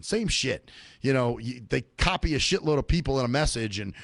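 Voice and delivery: usual speaking voice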